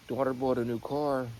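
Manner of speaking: normal talking voice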